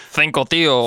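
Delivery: Castilian Spanish, TH in "Cinco"; Spain accent. Pretend. Co-host playfully echoing the Spain example, Castilian accent. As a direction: play a Spaniard saying "Cinco, tío". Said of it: "In Spain, they'd say cinco".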